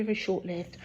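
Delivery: ordinary talk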